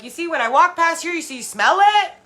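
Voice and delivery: very high, very loud pressing; insistent yell. Retold or imitated. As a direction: push them loudly to admit it. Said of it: "I'm like".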